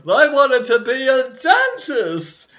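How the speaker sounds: high loud mock-wistful plea; Hermey voice. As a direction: pine it out like the elf. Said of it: "And then Hermes is like".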